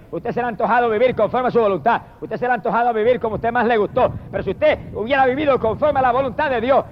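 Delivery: ordinary delivery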